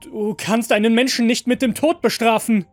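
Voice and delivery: high, loud, urgent appeal; desperate protest. A narrator's reading. Master at gunpoint arguing with android, loud and desperate. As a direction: protest as if your life depends on it. Master argues for his life.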